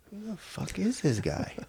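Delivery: hushed, high-pitched imitation of disbelief; soft, strained aside about an intruder; baffled coach. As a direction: mutter it softly as the puzzled head coach. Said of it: "imagine being this serious head coach".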